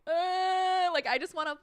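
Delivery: high mock wail; mock crying. Retelling being moved by the thought of flying, mock crying. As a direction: pretend to burst into tears, pitched high. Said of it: "I would just start crying".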